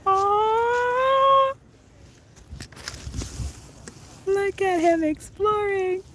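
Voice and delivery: high, drawn-out cooing; adoring coo. Real, not acted. Streamer watching him exploring, adoring coo. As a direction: coo high and slow over something cute.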